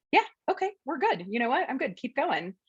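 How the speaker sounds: high, light dog voice, playfully content; squeaky pet voice saying all is fine; voicing the dog. Trainer voicing the calm shelter dog, high and light. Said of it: "And she's still like".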